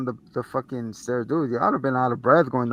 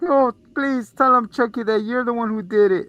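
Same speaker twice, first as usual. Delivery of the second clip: pleading psych guy; 10 semitones higher, 4 dB louder than usual. Retold or imitated.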